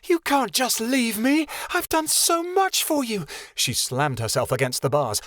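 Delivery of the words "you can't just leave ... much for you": very high, slow, furious pleading; jilted girl raging at Keanu; hurt rage. A narrator's reading. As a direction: rail at him through the bars. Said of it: "her hurt taken over by rage".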